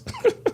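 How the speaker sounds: short high mocking laugh; scoffing laugh. Real, not acted. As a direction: laugh him off. Mocking the troll.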